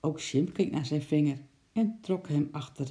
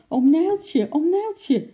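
very high, loud worried call; 13 semitones higher, 9 dB louder, slower than usual. Acted. Lotje runs in calling the zookeeper, worried. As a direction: call him urgently from afar. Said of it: "riep ze bezorgd".

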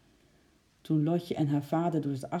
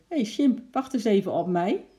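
high, louder call from a small animal; little squirrel calling; 5 semitones higher, 5 dB louder than usual. A narrator's reading. Squirrel calling to the chimp to wait, high and eager. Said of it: "alsof hij zeggen wilde".

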